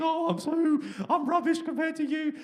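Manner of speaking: high, gushing starstruck fan voice; squeaky, awestruck little fan voice. Retold or imitated. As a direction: gush up high like you've met your hero. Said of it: "you might think".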